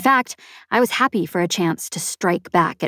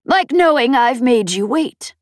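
teasing pirate boss; 6 semitones higher, 4 dB louder than usual. A narrator's reading. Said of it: "Peg said with a laugh".

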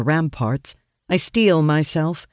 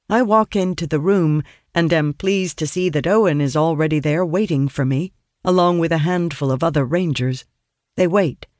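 ordinary delivery

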